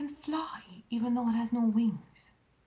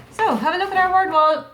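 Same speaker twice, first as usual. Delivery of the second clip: eager; 10 semitones higher, 11 dB louder than usual. Real, not acted. Teacher rallying class to the wall.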